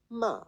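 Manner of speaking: ma starting high, then falling; fourth tone ma. Shown as a demonstration. Teacher demonstrating Mandarin tones, falling ma. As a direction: drop ma sharply from the top. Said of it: "you will hear".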